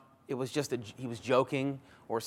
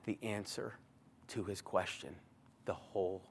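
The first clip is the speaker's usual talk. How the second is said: much softer and lower, near a whisper; hushed; 4 semitones lower, 7 dB quieter than usual. Real, not acted. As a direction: draw the listeners in quietly. Hushed dramatic build.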